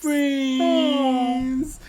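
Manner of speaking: high, cooing mock sympathy; mock aww. Teasing the killers as best friends, high mock coo. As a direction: coo it sarcastically.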